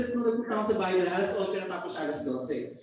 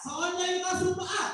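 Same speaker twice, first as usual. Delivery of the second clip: hyping the crowd; 8 semitones higher than usual. Real, not acted. Hyping up the live audience.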